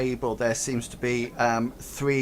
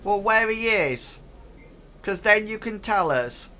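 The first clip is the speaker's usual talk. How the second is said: higher goblin voice; literal goblin; 7 semitones higher than usual. Acted. Goblin giving the players a literal answer. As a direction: answer with simple earnestness.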